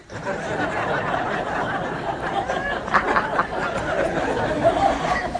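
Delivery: long high-pitched burst of laughter; real laugh. Real, not acted. Laughing at her own story.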